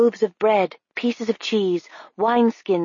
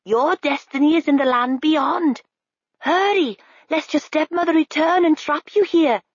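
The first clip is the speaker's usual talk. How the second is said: high bird voice pressing her on; high urgent raven; 9 semitones higher than usual. A narrator's reading. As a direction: hurry her toward her destiny. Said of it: "said the raven".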